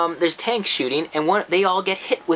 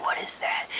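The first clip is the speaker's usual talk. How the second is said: unvoiced hushed whisper; 7 dB quieter than usual. Kid hears a noise, whispering. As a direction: breathe it like a scared kid. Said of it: "he's like".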